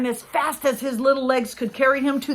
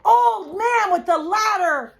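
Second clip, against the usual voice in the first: last-breath shout; 8 semitones higher, 6 dB louder than usual. A narrator's reading. Boy's final shout to old man, loud. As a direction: push out the last bit of breath. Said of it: "he shouted".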